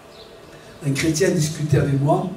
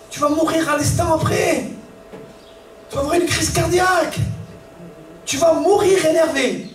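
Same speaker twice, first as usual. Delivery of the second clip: fiery preaching; 9 semitones higher than usual. Acted. Pastor warning believers that anger will kill them, shouted preaching. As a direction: thunder the warning at the congregation.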